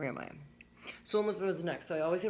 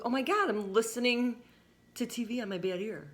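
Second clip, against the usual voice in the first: excited shock; 8 semitones higher, 4 dB louder than usual. Retold or imitated. Retold shocked discovery.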